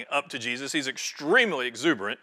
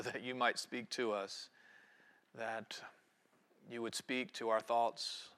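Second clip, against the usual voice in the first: prayer; 7 semitones lower, 8 dB quieter than usual. Real, not acted. Preacher praying to Almighty God before the sermon, soft low prayer. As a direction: pray softly, phrase by phrase.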